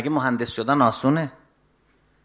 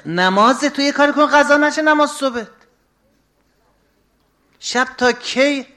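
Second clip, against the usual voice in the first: put-on raised, higher exhortation to the young men; preaching at full force; 11 semitones higher, 7 dB louder than usual. Preacher urging the youth about dawn prayer, raised and forceful. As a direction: call it out like a warning from the pulpit.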